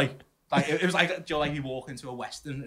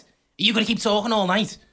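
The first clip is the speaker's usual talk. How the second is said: annoyed woman; 8 semitones higher, 7 dB louder than usual. Retold or imitated. Woman scolding him retold.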